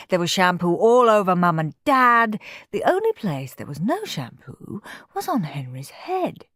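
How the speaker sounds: ordinary delivery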